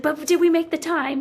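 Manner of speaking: insistent retold panic. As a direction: press the question urgently, high and loud. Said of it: "I was panicking that we weren't gonna make the time".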